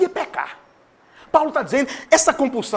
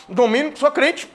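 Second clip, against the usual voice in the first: normal talking voice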